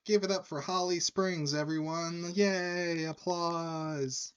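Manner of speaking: high-pitched emcee call for applause; hyping bartender. Retold or imitated. Bartender asks the bar to applaud the singer, hyping. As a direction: pitch it up like a bar host working the room. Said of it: "the bartender is like".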